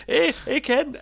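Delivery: put-on loud, high Scottish accent, jovial; jolly Scotsman. Scotsman teasing the airport security guard, loud jolly Scottish accent. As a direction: boom it out like a cheery Scot at the airport. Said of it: "the guy".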